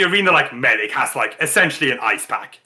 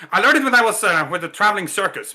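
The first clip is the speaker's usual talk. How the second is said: higher, louder showman; higher, louder hearty champ; 7 semitones higher, 3 dB louder, faster than usual. Acted. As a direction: boast of the circus days. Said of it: "he's like".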